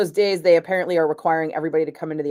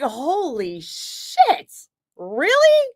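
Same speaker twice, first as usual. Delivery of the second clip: stunned excitement; 8 semitones higher, 3 dB louder, much slower than usual. Retold or imitated. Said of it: "So I was like".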